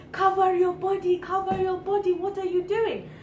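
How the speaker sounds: high, sustained mock yell; imitated grown man yelling. Friend imitating a stranger who shouted at her, high mock yelling. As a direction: holler it like a scolding man. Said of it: "like, yelling, like, grown man yelling".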